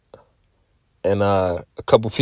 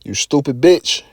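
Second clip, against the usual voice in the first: higher mock-angry muttered insult; inward angry insult; 4 semitones higher than usual. He voices his silent anger at the coworker, a higher mock-angry jab. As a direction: let the pent-up fury at the coworker slip out, half-joking. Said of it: "I was so pissed".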